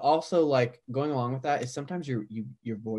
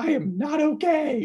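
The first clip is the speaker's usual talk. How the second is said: higher, louder mock sob; mock bawling; 13 semitones higher, 5 dB louder than usual. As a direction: whine it as if in tears. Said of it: "I'm bawling".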